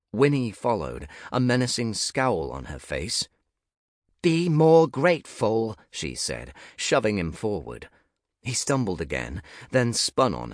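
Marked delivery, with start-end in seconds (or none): 4.2-5.8 stern rebuke. Acted. Winnie shoves Nick forward.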